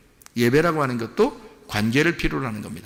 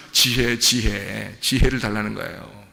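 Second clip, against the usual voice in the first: slow repeat; 4 semitones lower, slower than usual. Shown as a demonstration. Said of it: "drawn out".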